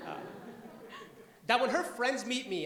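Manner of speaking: ordinary delivery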